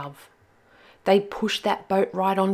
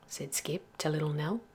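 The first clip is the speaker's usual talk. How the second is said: ordinary delivery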